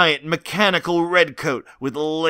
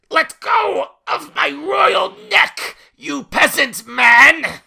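much higher, slow, yelling tyrant; dark lord; 11 semitones higher, 4 dB louder, slower than usual. Acted. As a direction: shriek like an outraged king. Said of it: "yelled out King George three in protest".

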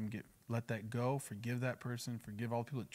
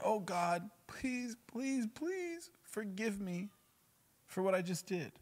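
slow mock pleading, pitched up; mock begging; 7 semitones higher, slower than usual. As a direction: imitate desperate begging for forgiveness. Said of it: "begging and crying out".

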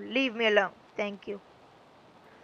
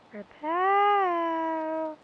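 high call; 6 semitones higher than usual. Acted. Calling for the item again.

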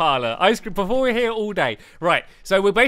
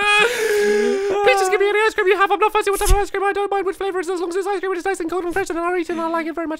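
high, fast pleading; speaking really fast; 9 semitones higher, faster than usual. Shown as a demonstration. Customer begging the shopkeeper for any ice cream, high fast pleading. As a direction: beg for any ice cream at top speed. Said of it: "Speak really fast".

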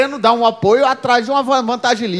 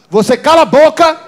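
louder, higher mock scolding of a child; scolding mother; 4 semitones higher, 5 dB louder than usual. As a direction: threaten the kid like an angry mom.

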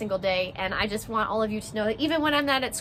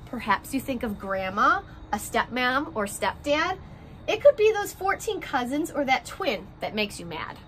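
gentle picture-book read-aloud, rhymes bouncing along; reading to children; 3 semitones higher than usual. A narrator's reading. Teacher reading a class picture book to her first graders, story-time voice.

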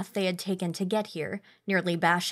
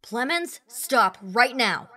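shouted command; 5 semitones higher, 4 dB louder, slower than usual. Acted. Calling after walking soldier.

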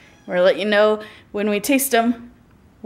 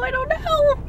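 high squeaky. Real, not acted. Dithering over menu.

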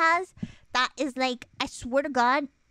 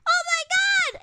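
high, loud reenacted shock; shocked outcry; 5 dB louder than usual. Sibling finding things burning in the oven, shocked outcry. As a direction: cry out in high-pitched horror.

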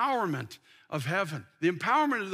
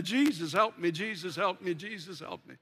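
rhythmic prayer fading; 4 semitones lower than usual. Retold or imitated. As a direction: chant it and let it fade. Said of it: "I got a rhythm in it".

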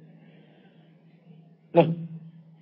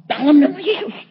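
loud, high prayer command; acted-out rebuke; 12 semitones higher, 9 dB louder than usual. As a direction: cry out a forceful rebuke in prayer. Acts out his rebuking prayer.

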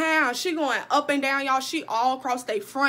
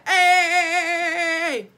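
loud high party whoop; 5 semitones higher, 5 dB louder than usual. Pretend. Girl hyping herself at a party, loud whoop. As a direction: whoop it up like you're twerking.

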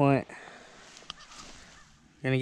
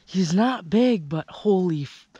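ordinary delivery

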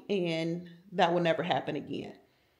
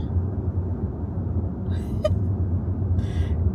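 breathy laugh. Real, not acted. Laughs at her own bit.

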